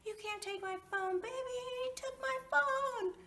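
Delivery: woman retelling her high-pitched scream at a cop; screaming protest. Retold or imitated. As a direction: scream at the cop taking your phone.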